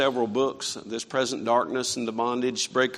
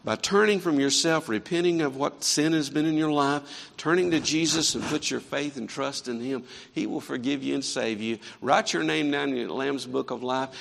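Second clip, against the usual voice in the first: usual speaking voice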